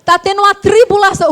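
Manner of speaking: high startled "O quê, mãe?", pitch jumping up; asking the mother in alarm, voice shooting up high; alarmed question. Retold or imitated. Daughter shocked at mother on the plane, high alarmed question.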